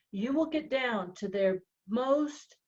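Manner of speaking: usual speaking voice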